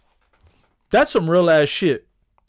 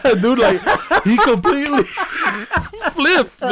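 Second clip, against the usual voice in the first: high, louder, breaking into laughter; laughing hard; 12 semitones higher, 4 dB louder than usual. Real, not acted. Telling a co-host through the joke, laughing hard. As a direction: struggle to talk through real laughs.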